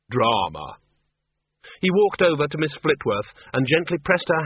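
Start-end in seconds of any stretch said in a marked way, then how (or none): 0.1-0.8 dismissive. Acted. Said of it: "he said dismissively".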